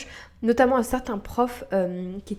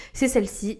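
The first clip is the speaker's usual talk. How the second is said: normal talking voice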